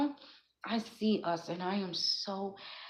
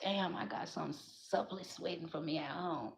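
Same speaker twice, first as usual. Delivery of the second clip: imagined man's pleased murmur; 2 semitones lower, 9 dB quieter than usual. Retold or imitated. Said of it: "and be like".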